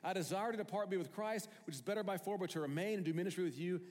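high, loud eager voice, fast in places; a torn apostle thinking out loud, voice climbing; Paul voiced high and loud in the sermon; excited Paul. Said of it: "he says".

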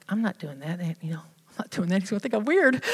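hushed, breathy self-talk, quick and secretive; murmured protest under the breath; whispered refusal. Retold or imitated. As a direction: whisper it like you're hiding from the room. Retold refusal of God's nudge.

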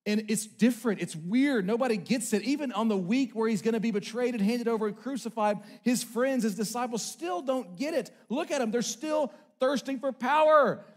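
ringing sermon climax; preaching at full force. Acted. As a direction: build the passion.

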